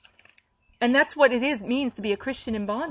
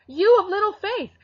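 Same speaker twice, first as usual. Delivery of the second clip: scolding; 9 semitones higher, 4 dB louder than usual. Retold or imitated. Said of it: "That's what he told them".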